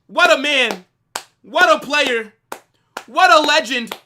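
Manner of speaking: loud, high hype praise; hype shout. Real, not acted. Streamer hyping up Figo, loud cheering praise.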